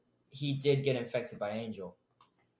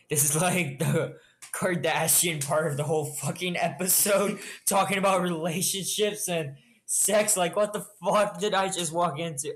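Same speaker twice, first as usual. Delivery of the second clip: higher-pitched incredulous rant; exasperated disbelief; 5 semitones higher than usual. Real, not acted. Listener walking into relationship gossip, exasperated and incredulous. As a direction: pile it up in comic outrage.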